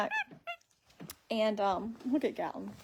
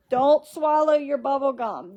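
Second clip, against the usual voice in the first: slower, louder sing-song warning; slow coaxing repeat; 3 semitones higher, 7 dB louder, slower than usual. Real, not acted. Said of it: "Make sure you don't".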